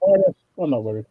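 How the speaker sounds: muffled voice through a face mask; muffled behind mask. Real, not acted. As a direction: talk muffled, barely breathing through the mask. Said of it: "you were sounding like that Batman villain".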